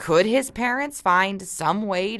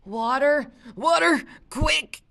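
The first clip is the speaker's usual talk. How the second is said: higher, slow hammed-up plea for water; mock gagging plea; 10 semitones higher, slower than usual. A narrator's reading. Mark fakes gagging on Luke's bread, hammed-up plea. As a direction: fake choking, beg for water. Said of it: "pretended to gag".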